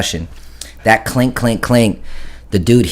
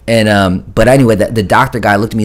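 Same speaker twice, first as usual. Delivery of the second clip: ordinary talk, nothing marked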